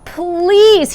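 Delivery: high pleading cry of please; desperate plea; begging. Acted. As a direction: beg the king, voice lifted. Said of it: "he begged for mercy".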